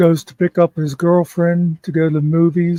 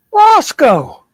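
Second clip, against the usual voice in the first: much higher, loud shout of the dog's name; grandpa shouting; 13 semitones higher, 7 dB louder than usual. Retold or imitated. Grandpa sniffs again and shouts at the dog, high and loud. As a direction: yell at the dog, fed up now.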